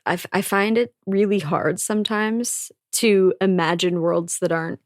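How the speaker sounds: normal talking voice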